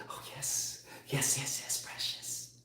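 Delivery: hissing creepy whisper; Smeagol whisper. Retold or imitated. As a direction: whisper like a greedy creature. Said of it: "like Smeagol".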